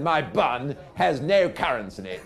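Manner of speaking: high, loud, insistent repeat; old captain. Retold or imitated. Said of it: "old captain said".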